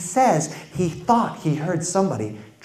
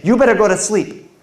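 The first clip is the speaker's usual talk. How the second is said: parent warning; 6 semitones higher, 5 dB louder than usual. Retold or imitated. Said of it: "They'll say".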